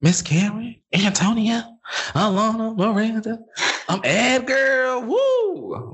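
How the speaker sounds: high hyped-up roll call; excited. Real, not acted. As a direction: call out each name with rising glee.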